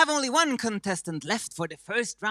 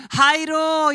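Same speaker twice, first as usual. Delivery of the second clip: excited greeting; 11 semitones higher, 12 dB louder than usual. Acted. Bright Swedish-style greeting.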